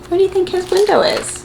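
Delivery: high, sing-song question; playful question to kids. Acted. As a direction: invite the little listener to guess. Asks young viewers.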